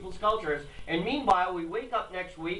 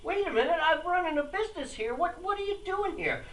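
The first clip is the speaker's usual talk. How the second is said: indignant business owner; 11 semitones higher than usual. Retold or imitated. Voicing an indignant shopkeeper.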